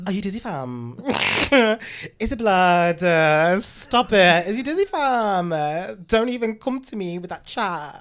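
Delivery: camp take on London roadman slang; london roadman accent. Pretend. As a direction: play a campy roadman, laughing. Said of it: "the campus roadman talk you could do".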